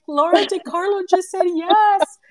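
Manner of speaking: high and loud triumphant cry; excited announcement. Retold or imitated. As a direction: shout the good news with joy. Announcing the news to her son.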